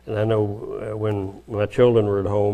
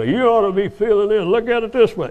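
raised, preachy mock lecture; lightly mocking know-it-all tone; bossy lecturing; 10 semitones higher, 3 dB louder than usual. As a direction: talk down to her like you know best.

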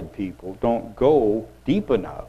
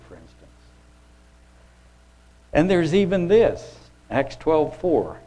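normal talking voice

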